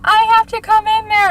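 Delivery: high, loud mock insistence; insistent girlfriend. Girlfriend demanding to enter the car, high loud mock plea. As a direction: push to get in, voice climbing high. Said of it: "she's like".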